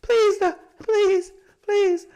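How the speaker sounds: put-on a small girl's high pleading to the Lord; child pleading. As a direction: imitate the toddler praying. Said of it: "She was like".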